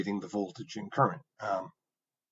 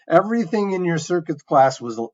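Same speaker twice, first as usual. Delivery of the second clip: ordinary talk, nothing marked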